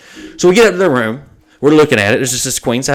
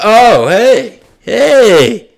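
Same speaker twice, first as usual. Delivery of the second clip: startled clerk; 9 semitones higher, 7 dB louder, much slower than usual. Retold or imitated. Clerk caught hiding, startled.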